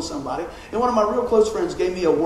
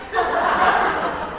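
burst of laughter. Real, not acted. Laughs at own joke.

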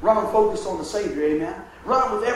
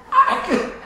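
much higher, cracking with laughter; laughing breathless; 9 semitones higher, much slower than usual. Retold or imitated. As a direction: laugh so hard you can't get air. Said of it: "Denise goes".

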